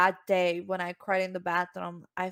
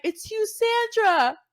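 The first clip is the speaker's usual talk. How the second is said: announcing the winner; 13 semitones higher, 5 dB louder, slower than usual. Retold or imitated.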